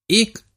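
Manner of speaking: one sharp tipsy hiccup; drunk hiccup. Acted.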